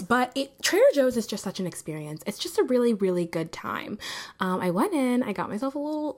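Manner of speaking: ordinary delivery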